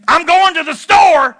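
yelled high and loud, barked out; angry shout. Shown as a demonstration. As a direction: shout it like you're fed up. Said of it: "there's a difference between saying".

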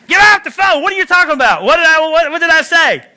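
high, loud mock yell of a mom; mother yelling. Mother yells at her son to get off the phone, loud mock yell. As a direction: bark it like an annoyed mother.

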